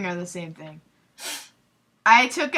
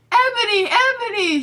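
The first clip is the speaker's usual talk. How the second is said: high, loud hammed-up wail of a name; mock sad shout; 12 semitones higher, 4 dB louder than usual. Draco calls after Ebony in a hammed-up high wail. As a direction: overact a tearful calling out. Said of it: "shouted Draco sadly".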